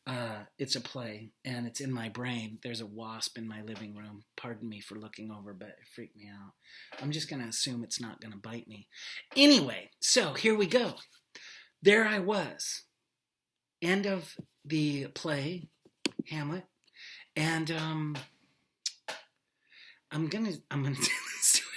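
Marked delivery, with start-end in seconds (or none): 2.6-8.9 distracted aside. Real, not acted. Distracted aside about a wasp. 20.1-21.7 fast whispered aside through a laugh; quick unvoiced aside with a laugh; giggly whisper. Real, not acted.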